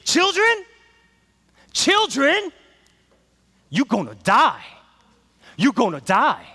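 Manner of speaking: high, loud, drawn-out preacher call; preacher's warning. Retold or imitated. Pastor warning the students with a loud, drawn-out preacher call. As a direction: preach it slow and heavy to the kids. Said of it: "He'll just say".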